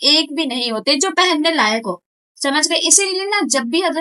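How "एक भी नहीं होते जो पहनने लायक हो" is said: normal talking voice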